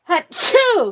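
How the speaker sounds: loud real sneeze; big explosive sneeze; sneezing. Real, not acted. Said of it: "I have to sneeze".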